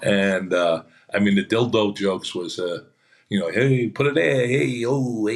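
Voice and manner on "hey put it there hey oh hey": lightly voiced rowdy bachelorette party guest; mildly hammed-up partier greeting; party guy impression. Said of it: "I've seen people talk like that".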